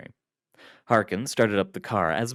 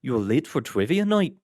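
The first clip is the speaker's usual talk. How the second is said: put-on Irish accent; 6 semitones higher, 4 dB louder than usual. A narrator's reading. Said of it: "in a heavy Irish accent".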